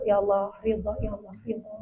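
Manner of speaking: very soft, low repeated murmur; hushed prayer of acceptance. Acted. Speaker models accepting Allah's will, hushed surrender. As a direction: murmur it privately to God, fading away.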